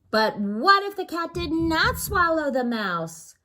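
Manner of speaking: high, loud alarmed cry of the butcher. Acted. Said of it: "cried the butcher".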